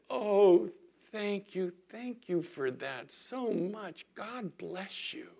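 imitating a melted woman, high, soft and slow; melted gratitude. Drive-through lady thanking a customer who blessed her, melting. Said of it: "she just melted".

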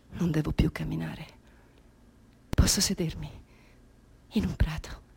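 relieved whisper. Acted. (sussurro sollevato)

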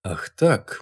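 usual speaking voice

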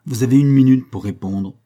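loud, cold and cutting; a merciless master laying down a deadline. A narrator's reading. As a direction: slice through the silence with each word. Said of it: "de sa voix qui tranchait dans le silence".

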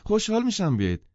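very high, eager woman's voice; pleading hostess, voice pushed right up; insistent. A narrator's reading. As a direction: press warmly, loud and high-pitched. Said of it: "زن باز اصرار کرد".

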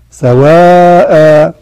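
سواء drawn out very slowly and loudly as "sawaa"; chanted سواء with a long stretched alif; long alif stop. Shown as a demonstration. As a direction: hold the final alif of سواء for two counts. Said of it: "حطيت ألف بعدها".

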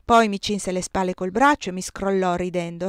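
ordinary delivery

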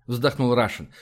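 ordinary delivery, nothing marked

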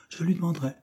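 high, keen promise; eager. A narrator's reading. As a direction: boyish burst of willingness. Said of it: "répondit Frank avec élan".

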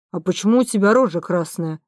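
ordinary delivery

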